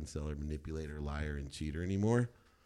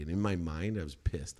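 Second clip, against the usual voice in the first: normal talking voice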